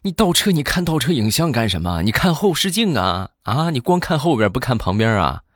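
high-pitched, indignant scolding questions; exasperated brother-in-law. Retold or imitated. Brother-in-law scolds sister over her reversing, mock exasperated. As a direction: act out an angry in-law berating a bad driver. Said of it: "当时把我那妹夫气的".

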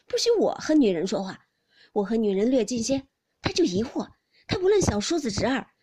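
loud, aggrieved tirade; blustering husband Jia Lian. Acted. Husband complaining to the maid about his jealous wife, loud bluster. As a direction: rant with jealous bluster, voice pushed up in force.

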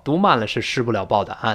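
ordinary talk, nothing marked

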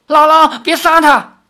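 high, loud desperate cry. A narrator's reading. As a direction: shout to stop her grandmother. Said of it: "赵灵儿叫道". (高声哭喊求饶)

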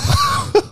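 quick high laugh, falling; high short laugh. Real, not acted. Laughs at the cheap scam.

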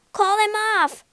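high, loud panicked scream. A narrator's reading. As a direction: shriek it in terror. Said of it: "screamed Nat".

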